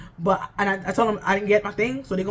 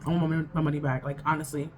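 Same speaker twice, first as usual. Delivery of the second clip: low, drawn-out, weary grumble; deflated annoyance; 6 semitones lower, 5 dB quieter than usual. Real, not acted. Fed up with the store, she says she's flat out annoyed. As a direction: sag into real annoyance over the lost money. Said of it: "I'm just so annoyed".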